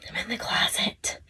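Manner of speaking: hushed whispered aside; confiding whisper. Real, not acted. Yoga teacher admitting where she is filming, confiding whisper. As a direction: whisper it like a little secret.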